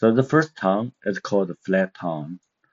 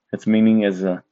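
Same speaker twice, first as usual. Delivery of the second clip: ordinary talk, nothing marked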